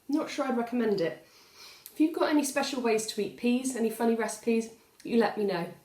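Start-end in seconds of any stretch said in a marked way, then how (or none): none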